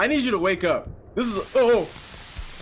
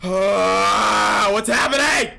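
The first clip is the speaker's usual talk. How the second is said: startled scream; 3 semitones higher, 5 dB louder, much slower than usual. Real, not acted. Jump scare in the game makes him scream out loud. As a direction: yell out in sudden fright.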